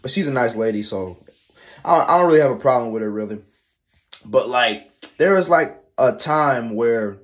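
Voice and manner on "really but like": normal talking voice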